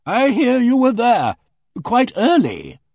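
loud, high and slow, a hearty boom. Acted. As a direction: boom it out like a bossy country lady. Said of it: "she boomed".

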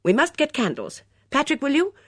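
urgent request, rising at the end; taking charge. Acted. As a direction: call out orders as hostess. Hostess takes charge.

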